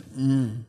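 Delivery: soft, contented mock groan of a dog; a low, pleased hum like a beagle getting a treat; happy dog groan. Preacher imitating his beagle Charlie hearing about cookies, happy groan. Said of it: "he lets out this happy groan".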